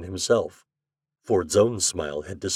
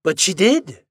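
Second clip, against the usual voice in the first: high, loud insistence; insisting; 11 semitones higher, 6 dB louder than usual. A narrator's reading. Doctor contradicting Ford's protest, insisting. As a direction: push back emphatically, pitch raised. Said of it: "insisted the doctor".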